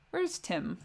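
usual speaking voice

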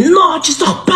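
lightly voiced djinn refusing; lightly voiced demon, man blocking her exit. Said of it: "il prenait une voix de shaitan".

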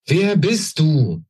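slow, high call into the empty room. A narrator's reading. Queen calling again after the vanished old woman. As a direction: call after someone already gone. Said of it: "rief sie noch einmal".

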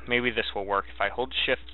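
ordinary talk

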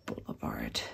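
breathy whispered punchline; whispering. Real, not acted. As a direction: whisper the last word. Whispered joke word.

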